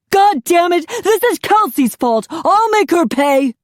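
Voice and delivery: loud, high furious yell, slowing at the end; husband raging at his wife; enraged threat. A narrator's reading. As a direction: vow revenge in rage.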